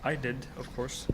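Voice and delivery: ordinary talk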